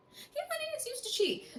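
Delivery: very high, quick and soft; high-pitched aside. Real, not acted. Dishing on the cheating ex, high-pitched aside. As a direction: squeak it out fast and small. Mock repeat about cheating ex.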